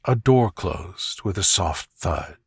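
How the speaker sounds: ordinary delivery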